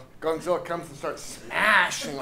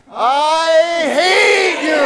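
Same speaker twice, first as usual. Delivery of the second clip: full-throated shout, much higher and louder; shouted with enjoyment; 9 semitones higher, 12 dB louder, much slower than usual. Shown as a demonstration. Teacher leads the group in a joyful hateful shout. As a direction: let it rip with gleeful hatred. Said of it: "let's do it like I'm enjoying myself".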